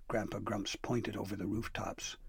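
plain reading voice

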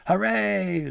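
loud, high crowd cheer; roaring cheer. Acted. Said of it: "citizens of Borealis roared".

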